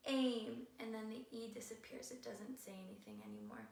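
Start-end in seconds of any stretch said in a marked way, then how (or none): 0.1-0.7 "aim" with the long A, the vowel saying its name. Shown as a demonstration. Teacher showing the class: long A in aim. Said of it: "makes the A say its name".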